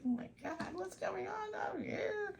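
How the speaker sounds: high, slow mock panic for the dog; high, slow, panicky dog voice; voicing the dog. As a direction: play the frightened dog, drawn out. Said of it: "She was like".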